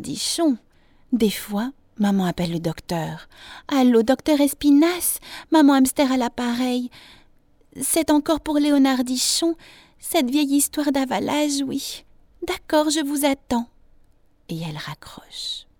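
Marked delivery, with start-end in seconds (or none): 3.7-13.7 higher-pitched mother on the telephone; hamster mother phoning. A narrator's reading. Mother rings the doctor about her son, higher phone voice. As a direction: voice a mum calling the doctor.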